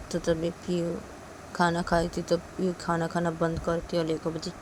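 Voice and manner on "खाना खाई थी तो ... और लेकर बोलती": ordinary delivery, nothing marked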